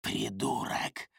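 slow, low rustling whisper. Acted. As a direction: hiss it like dry leaves rustling. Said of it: "прошелестел голос расчленителя".

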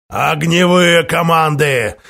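slow growled command; growled order. Acted. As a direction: roar the order at the crew. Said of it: "Рычание Баззы".

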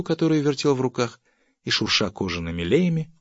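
usual speaking voice

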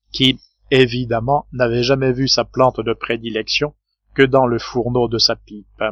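usual speaking voice

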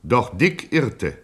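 ordinary talk, nothing marked

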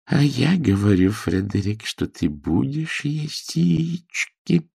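sweetly firm, ending slow and drawn out; friendly on top, dynamite underneath; friendly but menacing. A narrator's reading. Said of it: "намёк на взрыв динамита". (дружелюбно, но с угрозой)

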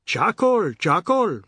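loud, high vendor call. A narrator's reading. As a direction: hawk it like a street seller. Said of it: "Mister Biswas called to them".

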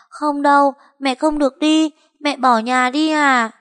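put-on a small child refusing to let her go; protesting little girl. A narrator's reading. Monkey protests her mother leaving, high and upset.

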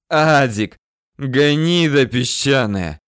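loud, slow, hoarse curse; hoarse wheezing. A narrator's reading. Dying chief curses his deserting man, wheezing hoarsely. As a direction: rasp the insult out with effort. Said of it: "хрипит Ахмед".

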